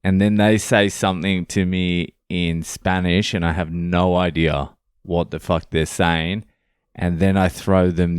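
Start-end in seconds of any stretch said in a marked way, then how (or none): none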